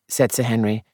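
ordinary delivery

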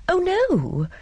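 sudden high-pitched alarm; distressed. A narrator's reading. Miss Marple quickly protesting to Louis, high distressed cry. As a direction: jump up high in dismay. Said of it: "in a distressed voice".

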